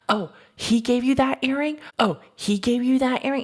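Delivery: high-pitched imitation of an outraged friend; mock confrontation. Pregnant friend confronting the girl about the earring, high mock outrage. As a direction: challenge the rival, pitched well up.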